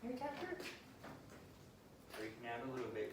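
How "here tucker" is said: high, lilting call to the dog; coaxing high call to the dog. Real, not acted. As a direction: float his name up to lure him. Calling the dog to him.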